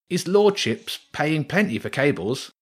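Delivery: a rat tempted by easy money; greedy rat. Acted. Rat eyes the cable.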